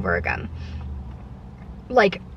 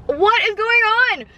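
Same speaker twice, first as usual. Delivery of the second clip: exasperated; 10 semitones higher than usual. Retold or imitated. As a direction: throw up your hands at the customer service rep. Exasperated retold phone call.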